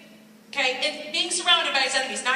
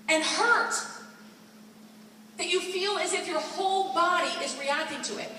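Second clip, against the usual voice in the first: usual speaking voice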